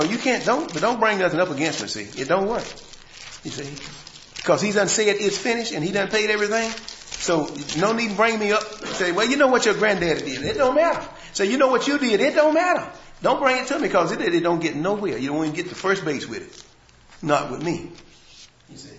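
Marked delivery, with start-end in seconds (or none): 4.4-16.3 louder, higher preacher's cry; rising to a pulpit pitch; fervent sermon; full-force preaching. Acted.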